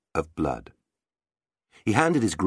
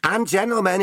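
gentle knight; 14 semitones higher, 4 dB louder than usual. Acted.

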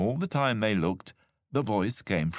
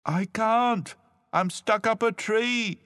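high, loud plaintive call; calling from above; 5 dB louder, slower than usual. A narrator's reading. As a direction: call down from a treetop. Hidden cousin calls from the treetop.

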